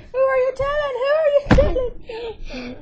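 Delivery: loud, high, furious demand; angry yell. Real, not acted. Repeated question, now yelling.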